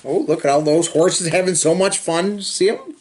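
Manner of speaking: usual speaking voice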